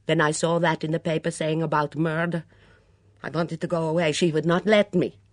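put-on Mitzi, hunted and defiant, in a heavy foreign accent; a suspicious foreign cook in a thick accent, rising to outbursts. Mitzi voiced by narrator.

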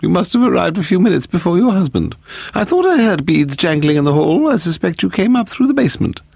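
high-pitched, stern society hostess; severe. A narrator's reading. Hostess greets Missus Temple who came up through basement, severe. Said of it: "Lady Delamore said severely".

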